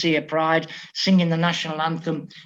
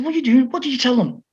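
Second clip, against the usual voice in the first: higher, alarmed coach shout-question; exasperated; 7 semitones higher, faster than usual. Retold or imitated. Coach alarmed at his players hitting the corner flag, higher exasperated questions. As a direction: react to the balls flying the wrong way. Coach sees balls going wrong way.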